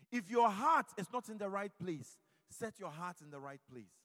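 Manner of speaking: shouted exhortation. Acted. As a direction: drive the plea home at full volume. Repeated louder exhortation.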